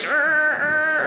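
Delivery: high, strained engine whirr; imitated car noise. Driver struggling to parallel park, imitated engine noise. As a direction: whirr like a car inching into a spot.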